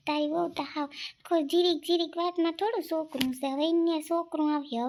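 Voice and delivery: ordinary talk, nothing marked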